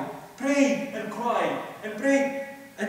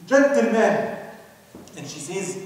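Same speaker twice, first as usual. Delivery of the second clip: ordinary delivery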